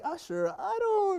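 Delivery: retold bewilderment, high and drawn out; mock bewildered protest. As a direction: imitate a slow, high-pitched protest. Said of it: "I was just like".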